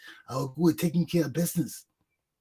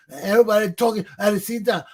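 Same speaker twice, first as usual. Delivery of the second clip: ordinary delivery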